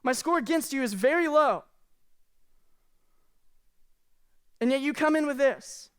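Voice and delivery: tally it up with a hurt edge, slowly; slow indignant reckoning. Retold or imitated. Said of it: "And say".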